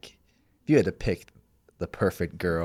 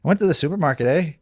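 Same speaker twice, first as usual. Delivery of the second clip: higher-pitched mock North Dakota accent; Fargo accent; 7 semitones higher, 4 dB louder, faster than usual. Playing a wife from Fargo, North Dakota accent. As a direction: lift it into a Fargo lilt with a tag question. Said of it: "what would she sound like?".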